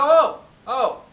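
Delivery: sharp shouted halt; loud interruption. Acted. Owner stopping client's threats, loud interjection. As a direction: cut him off at full volume.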